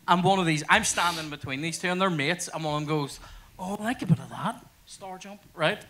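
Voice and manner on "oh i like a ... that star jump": softly imitated admiring aside; mock admiring. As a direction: coo it quietly, imitating an admirer. Voicing a woman admiring a passer-by.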